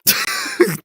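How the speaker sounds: high, breathy burst of laughter; laughing. Real, not acted. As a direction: crack up out loud.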